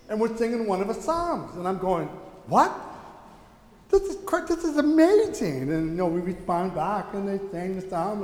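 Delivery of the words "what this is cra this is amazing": amazed reaction, pitched much higher; retold excitement. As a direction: replay being thrilled and astonished. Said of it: "and I'm excited".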